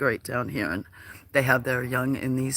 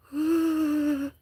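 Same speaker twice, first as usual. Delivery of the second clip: yawn; 13 semitones higher, 3 dB louder than usual. Real, not acted. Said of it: "Sorry for the yawning".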